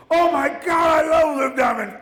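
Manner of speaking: high, loud squealing fan shout; imitating a drunk fan shrieking, high and loud; drunk girl shout.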